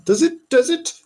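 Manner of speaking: squeaky mock-insistent query; high teasing question. Presses silent Marjorie for an answer, high and teasing. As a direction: prod her in a high put-on voice.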